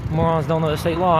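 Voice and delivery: ordinary talk, nothing marked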